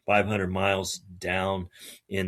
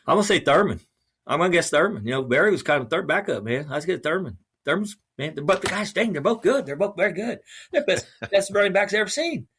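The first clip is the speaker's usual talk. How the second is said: animated pick; 9 semitones higher, 4 dB louder than usual. Retold or imitated. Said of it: "He goes".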